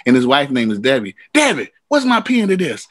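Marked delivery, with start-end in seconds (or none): 1.3-2.9 calling out to wife. Retold or imitated. James calling to his wife.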